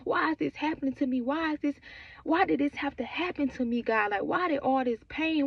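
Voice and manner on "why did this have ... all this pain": ordinary talk, nothing marked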